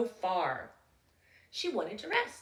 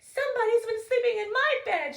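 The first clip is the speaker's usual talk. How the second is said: higher, sweet bear mother; sweet Mama Bear; 12 semitones higher, 6 dB louder, faster than usual. Acted. Mama Bear noticing her porridge, sweet and high. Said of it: "she said in her low, sweet voice".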